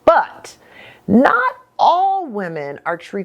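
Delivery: ordinary talk, nothing marked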